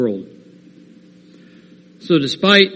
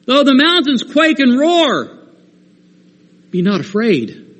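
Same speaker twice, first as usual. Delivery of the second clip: firm and ringing, slow on I am the Lord; rising to a high cry on the mountains line; emphatic; 7 semitones higher than usual. Acted. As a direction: declare it like the Lord who means business. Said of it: "our Father lets us know emphatically".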